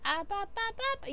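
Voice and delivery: high cute babble, ba ba ba; babbling reply. Retold or imitated. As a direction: chirp nonsense syllables back. Model answering in babble.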